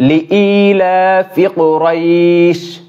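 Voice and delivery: slow, careful tajweed reading of লিইলাফি কুরাইশ; recited verse. Shown as a demonstration. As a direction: read লিইলাফি কুরাইশ slowly, every sound clear. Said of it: "প্রথম আয়াত নিয়ে আলোচনা করি".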